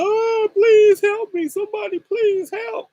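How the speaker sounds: high, loud cry for help; a man on his knees screaming for help; desperate scream. Retold or imitated. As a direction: beg the street for help at the top of your voice. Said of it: "he's screaming".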